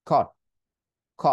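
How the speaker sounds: "খট" cut short, T not released; abrupt catch ending "খ"; unreleased stop T. Shown as a demonstration. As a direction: choke off "খট" before the T sounds. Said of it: "টিটাকে উচ্চারণ করবো না".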